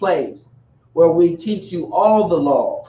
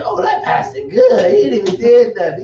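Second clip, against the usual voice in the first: mock churchgoer; 5 semitones higher, 4 dB louder than usual. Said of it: "You say".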